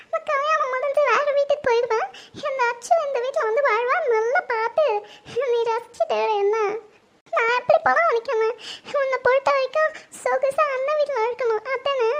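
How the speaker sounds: bitter words broken by sobs; crying complaint. Acted. Daughter resenting the rival moving into the house, crying complaint. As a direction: complain through tears, pitch unsteady. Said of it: "எதுக்கும்மா அவந்திக்கு அழுதுட்டு இருக்கா?".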